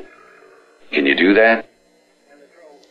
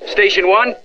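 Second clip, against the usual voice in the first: raised, high questioning call; 12 semitones higher than usual. Pretend. Caller trying to raise someone, high questioning call.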